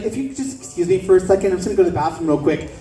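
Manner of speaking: rushed polite excuse; fast fake-polite brush-off; hurried excuse. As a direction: rattle it off fast to escape. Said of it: "She's like".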